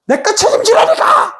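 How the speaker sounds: high-pitched, exasperated mock outburst; angry insistence. Person pressing a partner to take responsibility, angry high insistence. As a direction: insist angrily, voice shooting up. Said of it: "왜 화를 확 내냐면".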